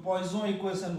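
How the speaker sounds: পয়সন read as spelt, "poi-son"; direct local pronunciation. Shown as a demonstration. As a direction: say পয়সন with a plain "oi" and final n. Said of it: "এরকম direct উচ্চারণ করতে চাই".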